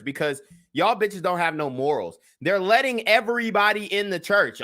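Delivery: ordinary delivery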